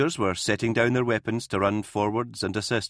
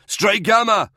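louder and higher repeated yell; 12 semitones higher, 8 dB louder than usual. A narrator's reading. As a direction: call again, more urgently. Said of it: "Dower yelled".

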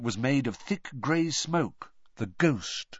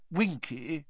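gentle, higher, rising address; kindly; 6 semitones higher, 3 dB quieter than usual. A narrator's reading. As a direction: coax softly, as to someone frightened. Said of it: "he said kindly".

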